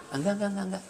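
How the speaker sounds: mock scared backing-down. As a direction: back off meekly, pretending to be scared. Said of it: "Kan dia takut tuh". (pura-pura ciut ketakutan, suara pelan)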